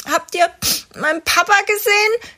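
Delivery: viel höher und lauter, verweinte Kinderstimme; schniefender kleiner Junge. A narrator's reading. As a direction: spiel einen verlorenen kleinen Jungen, der schnieft. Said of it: "schnieft er". (der kleine Junge fragt schniefend nach seinem Papa, hoch und laut)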